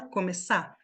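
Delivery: "começar" said as "começá"; silent final R. Shown as a demonstration. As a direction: cut "começar" off on the stressed A, no R. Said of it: "esse R também ... é mudo".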